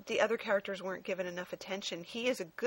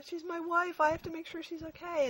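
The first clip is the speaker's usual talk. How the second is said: much higher, pleading hero voice; mock devoted husband; 9 semitones higher than usual. Mocking Giant Man rushing to his wife, high pleading. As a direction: play up the lovesick husband's urgency.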